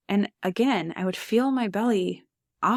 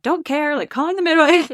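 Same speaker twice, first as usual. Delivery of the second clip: loud, high, insistent husband; a retold burst of urgency, insisting he's calling anyway; a husband who won't be talked out of it, loud and insistent; 8 semitones higher, 7 dB louder than usual.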